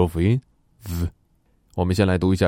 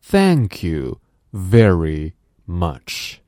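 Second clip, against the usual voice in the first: very slow, Thank with tongue between teeth; slow teacher demo; slow model phrase; 4 dB louder, much slower than usual. Shown as a demonstration. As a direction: spell it out for the class. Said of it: "Now repeat after me".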